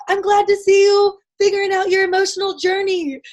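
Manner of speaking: high-pitched imitated holler over distance; retold yell. Sister-in-law in a hurry yells across the supermarket, imitated high holler. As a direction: sing out high, as if across a store. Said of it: "she yells it across the supermarket".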